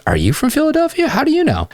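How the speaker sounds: be the intrigued woman; curious girl. Retold or imitated. Coach voices the woman now curious about him, high intrigued. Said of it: "And now she's curious".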